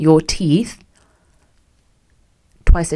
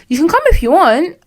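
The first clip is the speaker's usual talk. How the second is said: put-on offhand boyfriend; 11 semitones higher, 3 dB louder than usual. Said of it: "Oh, I'm actually going shisha".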